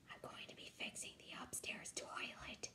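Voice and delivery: a secret, breathy whisper. Acted. Grandpa whispering a secret plan to his granddaughter. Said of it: "made his voice real quiet".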